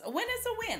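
"A win is a win." jumps way up high, sing-song; emphatic catchphrase. Real, not acted. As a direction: toss the phrase up high like a punchline.

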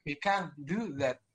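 slow, stressed can't; slow English stress, can't stands out. Shown as a demonstration. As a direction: lean on can't and draw out do. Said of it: "do-nya aja udah panjang ya".

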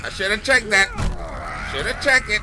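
frustrated shout. Real, not acted. Yelling at himself over locked door.

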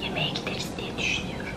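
soft, secretive whisper; hushed voiceless aside. Real, not acted. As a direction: whisper it to the viewers. Confides birthday plan to camera.

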